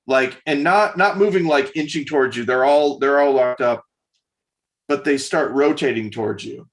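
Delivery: normal talking voice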